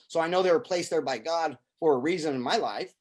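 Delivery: normal talking voice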